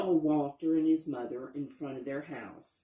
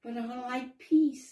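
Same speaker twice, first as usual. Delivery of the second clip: higher, louder protest; gentle wolf objecting, raised voice; pleading; 8 semitones higher, 6 dB louder than usual. Acted. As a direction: Walter begging to stay peaceful. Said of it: "said Walter".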